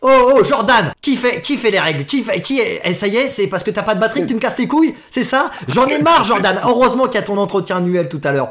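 hammed-up exasperated yelling; mock angry rant. Host fakes rage at Jordan.

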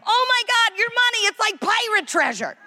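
high, gushing over-excited tourist voice; wide-eyed American gushing over money. Retold or imitated. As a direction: squeal like a tourist seeing coins.

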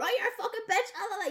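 high-pitched joking insult; mock outrage. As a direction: squeal it like a playful jab. Friend jokingly insults, eye stinging.

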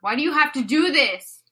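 insistent mock lament; exasperated pleading. Reader reproaching the singer, exasperated insistent plea. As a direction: repeat the plea with force. Repeats the lament more forcefully.

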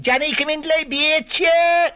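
put-on high, loud, piercing Marsh Cork accent yell; harsh shrill Cork accent. Mother calling Johnny in from the street, shrill high Cork accent shout. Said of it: "harsh, shrill point of the accent".

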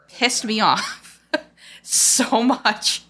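slow, laughing through anger; drawn-out bitter laugh. Real, not acted. Bitter laugh recalling sister's death.

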